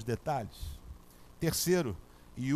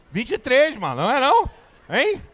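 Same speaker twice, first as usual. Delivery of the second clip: much higher and louder, frantic; frantic panic; 7 semitones higher, 9 dB louder than usual. Retold or imitated. Himself panicking on the plane, higher and loud. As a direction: grab the answer in a panic.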